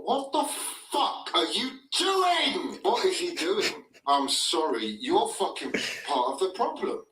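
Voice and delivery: loud, high outraged yell; furious shout. Real, not acted. Keeper error, yelling.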